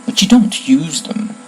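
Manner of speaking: a touch of sharp suspicion; sharply. A narrator's reading. Wand seller checks on the forbidden broken wand, sharply. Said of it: "said Mister Ollivander sharply".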